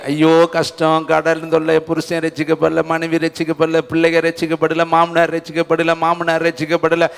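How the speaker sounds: a hint of weary self-pity; mock lament. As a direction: imitate a believer whining about troubles. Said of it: "இந்த வருஷம் பூரா ... புலம்புனீங்க".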